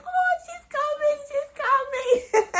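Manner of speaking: very high, loud squeal of joy; squealing excitement. Retold or imitated. Daughter hearing her mother is coming, squealing with joy. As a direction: squeal with delight. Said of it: "I'm like".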